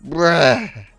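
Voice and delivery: loud, drawn-out disbelief; retelling his shock at the haircut, loud and slow; exasperated loud bruh. Retold or imitated. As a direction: groan it out loud at the hair. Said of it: "just looking at this fucking hair like".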